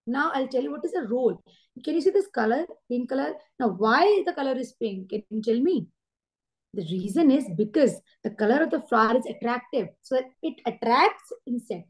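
slow and clear, asking the class; slow teaching demo. Shown as a demonstration. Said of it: "जब हम धीरे धीरे बोलते हैं ना". (धीरे-धीरे समझाते हुए)

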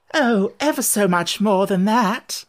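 high, loud, slow lament; moaning. A narrator's reading. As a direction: wail it slowly in dismay.

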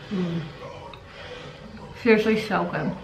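chewing while talking; words muffled by food; mouth full of candy. Real, not acted. Seen 2: Right speaker bites candy at 0.1-1.6, then talks while chewing.

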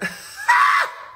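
high shrieking laugh; sudden high squeal of laughter. Real, not acted. Loud laugh after Leo brag.

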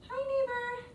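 high little hello; cutesy high-pitched greeting; playful greeting. Pretend. Playful greeting with butterfly wing.

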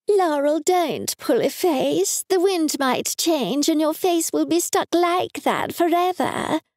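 high, slow scolding mother; high nagging mum voice. A narrator's reading. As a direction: wag a finger at the grown daughter. Mum scolding her daughter.

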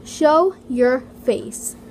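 drawn out loud and slow as a demand; slow loud comment read. Acted.

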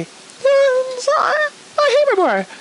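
high, halting mock reply; high-pitched character voice. Character stammers an answer to the cop, high-pitched voice. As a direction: squeak out a hesitant answer.

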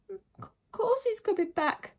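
put-on high, slow little-boy voice; small, hurt insistence. Younger brother insists dad will return, small high voice. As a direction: insist timidly, without raising the volume. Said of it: "Buddy insisted".

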